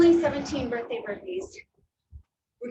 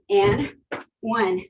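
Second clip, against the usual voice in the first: counting through burpees; 3 dB louder, slower than usual. Real, not acted. Counting aloud while doing burpees.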